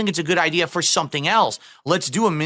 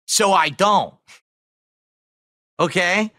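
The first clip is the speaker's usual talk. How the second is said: wild unhinged ranting; 3 semitones higher, slower than usual. Real, not acted. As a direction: pound each word home. Escalating rant at complainers.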